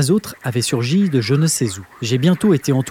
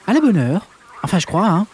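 voix de grenouille un peu ahurie, plus aiguë; grenouille hébétée; 5 semitones higher than usual. Acted. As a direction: joue la grenouille perdue. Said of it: "avec un air hébété". (grenouille hébétée, voix haute)

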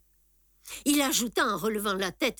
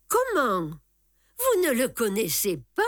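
astonished; 4 semitones higher than usual. A narrator's reading. Conductor amazed at Tartarin's ignorance.

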